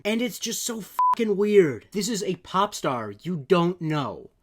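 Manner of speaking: incredulous fury rising to a shout at the end; exasperated rant. Real, not acted. Outraged rant about creepy tweet.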